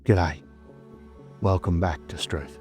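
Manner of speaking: ordinary delivery, nothing marked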